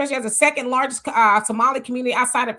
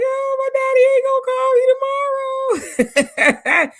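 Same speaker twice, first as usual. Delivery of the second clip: high, breaking cry of grief; tearful wail; 13 semitones higher, 4 dB louder than usual. Retold or imitated. Host retelling crying over her late father at the dishes. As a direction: let the voice crack and climb into a sob. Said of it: "I was like".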